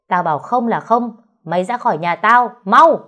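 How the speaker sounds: rising to a loud high yell on mau; furious shout. Acted. Mother-in-law yells at daughter-in-law to get out, furious. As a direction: build from firm to an explosive shout. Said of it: "Bà quát to".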